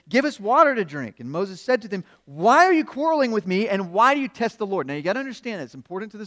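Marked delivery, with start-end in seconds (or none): 0.1-1.2 loud, raised-pitch demand; acting out a quarreling crowd; quarrelsome demand. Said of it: "the people quarreled with Moses and said". 2.3-4.9 loud, high, indignant questioning; exasperated challenge. Acted. Moses answers the quarreling crowd.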